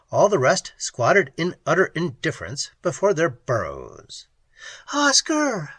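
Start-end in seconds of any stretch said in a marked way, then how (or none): 4.9-5.7 scream. A narrator's reading. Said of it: "Pat screamed".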